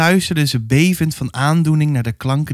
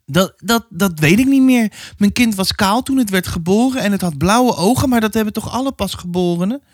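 a hint of a flustered, slightly higher mother; 8 semitones higher than usual. Mother defending her baby to the neighbour, lightly voiced and unsure. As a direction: stammer like an unsure young mother.

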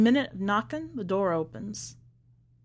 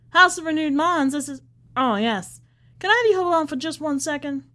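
high, louder polite receptionist voice; phone greeting; 7 semitones higher, 5 dB louder than usual. Acted. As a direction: answer the church line brightly. She answers the church phone.

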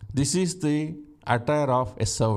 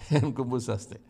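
laughing repeat; 3 semitones lower than usual. Shown as a demonstration. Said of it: "Don't worry about it".

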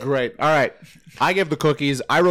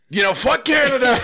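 mock Trump; 11 semitones higher than usual. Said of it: "the old Trump accent".

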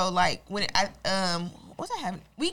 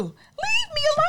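high loud defiant shout; shouted leave me alone; 5 dB louder, slower than usual. Acted. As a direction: yell it defiantly.

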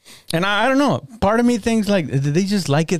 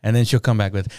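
ordinary talk

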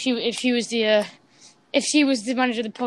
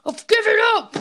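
loud, much higher yell of defeat; frustrated shout; 10 semitones higher, 8 dB louder than usual. Real, not acted. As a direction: throw up your hands and yell.

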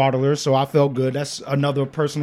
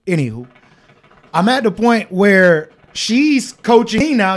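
ordinary talk, nothing marked

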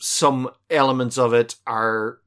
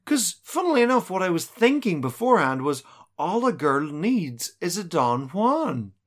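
dreamy woman voice; 5 semitones higher than usual. Retold or imitated. Voicing Barrows dreamily.

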